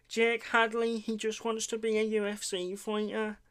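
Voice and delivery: lightly voiced mock impression of Jake Hadley; fighter impression. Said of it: "I need to stop doing these impressions".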